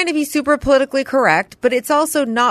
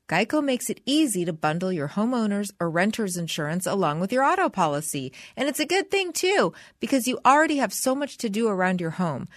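ad read; 3 semitones lower, 6 dB quieter than usual. Acted. Host reads insurance ad.

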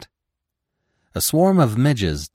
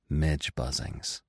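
musing to himself; 7 semitones lower, 9 dB quieter, slower than usual. Acted. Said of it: "he thought".

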